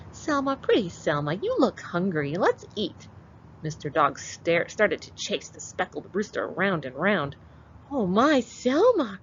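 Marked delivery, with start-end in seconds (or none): 0.1-3.1 put-on high, sweet old granny calling. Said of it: "Granny took the heavy basket ... and said". 7.9-9.2 put-on high, sweet old granny calling. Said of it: "cried Granny".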